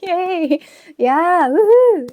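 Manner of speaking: loud high cheer. Real, not acted. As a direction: whoop with joy. Celebrating the news.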